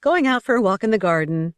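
falsely breezy, a touch too loud; peculiar voice. A narrator's reading. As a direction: put on a strange cheerful front for the receptionist. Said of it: "said in a peculiar voice".

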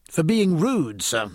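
put-on slightly prissy young midshipman; prim. A narrator's reading. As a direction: answer with a certain proper disapproval. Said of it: "with a certain primness".

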